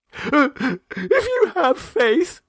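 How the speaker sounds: very high, slow, teary plea with a laugh-like catch; sobbing plea. A narrator's reading. Mrs Mummerby begs Miss Gilpert for help, high and teary. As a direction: plead through a mild sob. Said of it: "she sobbed".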